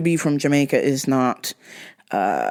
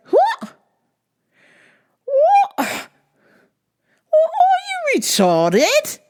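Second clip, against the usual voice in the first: incredulous shriek; much slower than usual. Retold or imitated. Daughter mocks father's Jamaican logic, high slow incredulous squeal. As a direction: shriek it in total disbelief. Disbelief at dad's claim.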